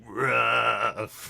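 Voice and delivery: drawn-out imitation dog bark; speaking for Nan. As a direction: stretch the word into a mock woof. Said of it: "go ahead and speak for Nan".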